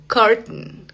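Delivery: "curtain" cut at the T, then a nasal n; glottal T. Shown as a demonstration. Teacher showing where the T stops, glottal T. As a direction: stop the air in the throat on the T of "curtain". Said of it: "Esa es una T glotal".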